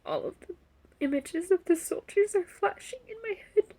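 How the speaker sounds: high, broken phrases between long tearful pauses; crying. Real, not acted. Survivor seeing images of brave soldiers, crying. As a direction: struggle to speak through real sobs, voice pitched high. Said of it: "I'm gonna cry".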